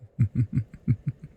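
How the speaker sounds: dark, delighted vampire laugh; sinister chuckle. Acted. Vampire imagines the chase, sinister chuckle. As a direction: chuckle at the thought of prey.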